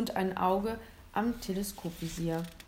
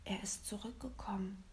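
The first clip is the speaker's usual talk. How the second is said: worried whisper; 10 dB quieter than usual. A narrator's reading. Boy behind the hedge, whispering in worry. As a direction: still hushed and anxious. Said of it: "flüsterte William besorgt".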